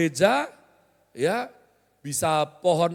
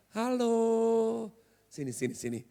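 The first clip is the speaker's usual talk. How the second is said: nicer summons; 2 semitones higher, 4 dB quieter than usual. Acted. Worker's wished-for way of being called, soft coaxing summons. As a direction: coax them over softly. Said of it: "sama-sama manggil kenapa sih nggak enak gitu".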